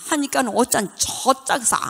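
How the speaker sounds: usual speaking voice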